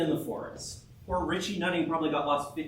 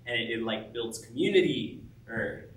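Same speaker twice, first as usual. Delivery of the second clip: normal talking voice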